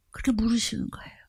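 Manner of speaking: breathy hushed whisper. Real, not acted. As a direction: drop to a whisper for the question. Preacher whispers God's question.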